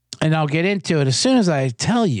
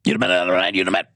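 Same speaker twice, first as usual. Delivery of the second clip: low repetitive radio chant, lightly voiced; imitating the radio host chanting you the man; imitating Scott Farrell; 5 semitones lower, faster than usual. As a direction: riff like a talk radio host hyping the caller. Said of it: "He talk like this".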